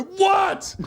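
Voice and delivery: high-pitched mock yell; retold cry of exasperation; exasperated retort. Acted exasperated reply to the voice.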